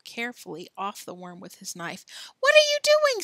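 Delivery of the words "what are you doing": very high, loud challenge; alarmed outburst. Acted. Alan sees Billy scraping off cornmeal, alarmed high outburst. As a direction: cry out in alarmed protest.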